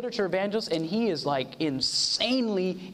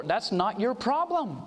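ordinary talk, nothing marked

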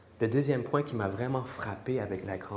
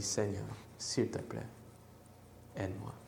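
soft kneeling plea; 4 semitones lower, 10 dB quieter than usual. Acted. Kneeling woman begs Jesus.

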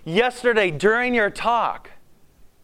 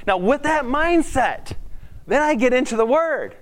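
eager; 6 semitones higher than usual. Real, not acted. Builds toward reading scripture.

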